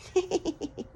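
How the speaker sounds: high cackling witch laugh. Pretend. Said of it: "That was my witch laugh for you".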